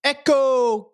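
loud high shout; shouted echo. Shown as a demonstration. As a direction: belt out the word like testing an echo. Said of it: "can you shout out the word echo".

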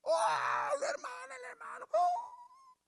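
high, wailing cries of scandalised surprise, hammed up; mock shocked cry. Churchgoers shocked at a brother's sin, mock wailing cry. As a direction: mimic a churchgoer shrieking in shock. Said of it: "se sorprenden como que".

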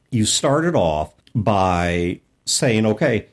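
ordinary talk, nothing marked

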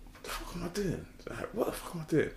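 hushed, retold inner panic; very soft self-questioning aside; muttered to himself. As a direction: act out a thought under your breath. Retold alarmed thought to himself.

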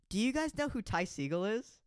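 high, drawn-out asking; eager question. Retold or imitated. Girl asking Jaden about his favorite artist, eager.

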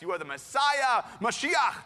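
high, loud acted declaration building to a shout; acted triumphant shout; exclaimed proclamation. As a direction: proclaim it with full-voiced conviction. Acts Peter's proclamation of Messiah.

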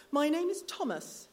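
put-on prim little boy introducing himself; the boy stating his name; child's voice. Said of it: "said the child".

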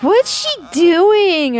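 high-pitched child imitation; drawn-out mock kid question; nosy kids. Kids peeking through the fence crack, high childish puzzlement. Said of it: "and they're like".

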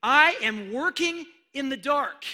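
high, loud declaration; voice of God proclaiming. Retold or imitated. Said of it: "That's what he said".

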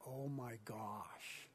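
very low, soft and slow; near-whispered disbelief; hushed awe. Real, not acted. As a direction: breathe it out, stunned. Stunned by the scene he reads.

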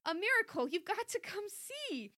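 high, excited and lightly breathless; panting. A narrator's reading. Mom summons daughter after running upstairs, lightly panted. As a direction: urge it with a touch of panting. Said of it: "she panted".

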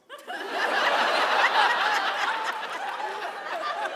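high burst of laughter; loud laugh. Real, not acted. Laughing after boat news.

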